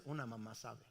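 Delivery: low, soft mother's certainty; quietly knowing. Retold or imitated. Wife answering her puzzled husband, quietly knowing.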